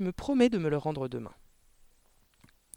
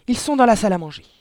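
fast, high, panicky; strangled voice; 7 semitones higher, 10 dB louder, faster than usual. A narrator's reading. Mother fearing burglars in the dining room, strangled, hurried cry. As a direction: blurt it out in fright. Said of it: "d'une voix étranglée".

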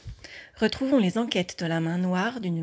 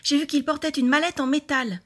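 raised, high, urgent report; urgent shouting; 5 semitones higher, 5 dB louder than usual. A narrator's reading. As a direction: call out the news loudly to friends. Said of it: "cria-t-il".

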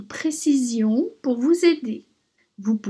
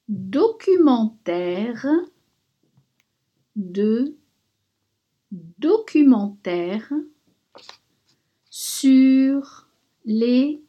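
dictating. Shown as a demonstration. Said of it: "Maintenant, je vais vous la dicter".

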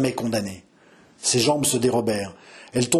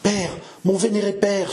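higher-pitched, tender plea; supplicating; 7 semitones higher than usual. A narrator's reading. Daughter begging her father to consent, supplicating. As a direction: implore a revered father. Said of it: "supplia Jeanne".

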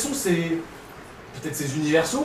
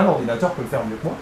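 ordinary delivery

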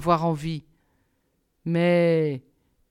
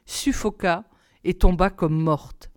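ordinary delivery, nothing marked

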